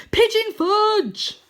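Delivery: loud, high, drawn-out spell cry; cried spell. Acted. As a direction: blast the magic words out.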